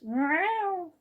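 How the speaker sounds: high falling cat yowl; yowled meow. Acted. Girl yowling at the astonished housekeeper as she leaves. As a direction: yowl at her as you run off. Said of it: "she yowled".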